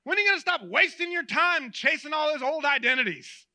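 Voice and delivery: high, loud mock telling-off; scolding yell. Imagined Jesus berating the disciples from shore, scolding yell. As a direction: yell a reproach across a distance. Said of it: "doesn't yell across the shore and go".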